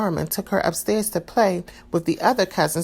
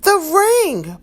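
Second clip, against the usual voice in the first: high, loud near-scream; almost shriek it in delight; 13 semitones higher, 6 dB louder than usual. A narrator's reading. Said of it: "Maria nearly screamed".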